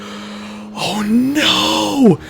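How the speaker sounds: high, strained, hushed mock dread; retold panic. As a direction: gasp it out like the dream is turning. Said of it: "I just went".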